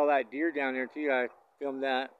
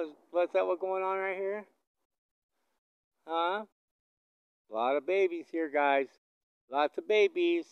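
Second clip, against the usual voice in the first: higher, sing-song voice for the cows; pet talk; 8 semitones higher, 4 dB louder than usual. Real, not acted. Rancher chatting to cows and calves, higher sing-song. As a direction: coo at them like pets.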